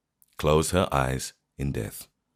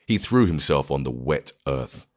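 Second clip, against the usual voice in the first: ordinary delivery, nothing marked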